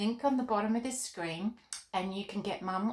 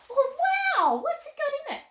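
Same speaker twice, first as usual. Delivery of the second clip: put-on high, loud little girl puppet squeal; Molly the puppet thrilled about the art pack, high and excited; 7 dB louder than usual.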